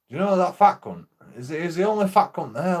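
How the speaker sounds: insist, exasperated. Retold or imitated. Retold argument with policeman.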